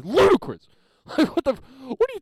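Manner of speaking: loud, high exclaiming ludicrous; shouted ludicrous. Real, not acted. Comedian shouts at his own childhood story, loud exclaim. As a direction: yell the word like it's absurd. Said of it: "I know, that's ludicrous".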